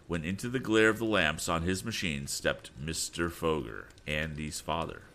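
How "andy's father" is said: usual speaking voice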